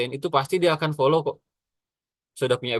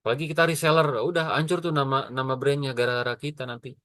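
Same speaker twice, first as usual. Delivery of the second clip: normal talking voice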